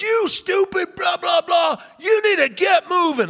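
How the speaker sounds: loud, high, hammed-up rant; acted road-rage tirade; furious driver. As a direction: scream abuse at the car ahead.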